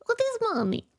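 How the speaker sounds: high, fast mock Russian accent; a lightly put-on Russian mother; fast, high-pitched mock Russian mom voice; Russian mom impression. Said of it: "And she'd be like".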